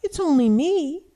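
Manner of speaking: high, loud little goat voice; high-pitched small goat answering the troll; smallest goat. A narrator's reading. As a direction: pipe up as the little billy goat. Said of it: "said the smallest goat".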